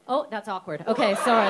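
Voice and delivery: normal talking voice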